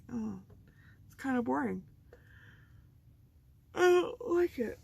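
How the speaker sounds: a fussy critic turning her nose up; imagined picky viewer. Retold or imitated. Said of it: "I would think".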